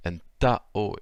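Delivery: antao drawn out slowly, syllable by syllable; slow halting try at antao. Shown as a demonstration. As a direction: spell antao out slowly, a little unsure. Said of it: "l'accent tonique est sur le ta".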